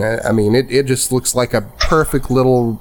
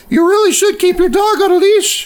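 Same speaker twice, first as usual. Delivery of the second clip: put-on high, louder old woman's scolding; prim old lady; 3 dB louder than usual. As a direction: tut at them like a fussy grandma.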